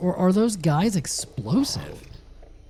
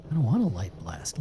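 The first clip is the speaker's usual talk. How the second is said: usual speaking voice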